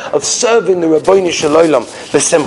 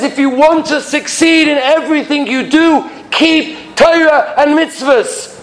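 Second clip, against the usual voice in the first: emphatic preaching; 6 semitones higher than usual. Acted. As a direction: hammer home the key to life.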